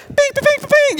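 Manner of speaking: very high-pitched bings, one after another; ping out each bing like cords snapping; cartoon bing effect. Preacher voices Samson breaking free with high cartoon bings.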